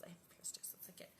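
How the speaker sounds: breathy whispered aside; hushed confiding whisper. Real, not acted. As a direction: drop to a whisper, just to yourself.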